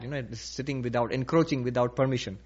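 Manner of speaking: ordinary talk, nothing marked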